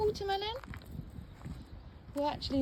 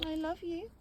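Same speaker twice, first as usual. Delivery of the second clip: higher sing-song cooing at the cat; cooing pet voice; 6 semitones higher than usual. Real, not acted. Cooing love to her cat, lifted. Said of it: "repeated to cat, higher second".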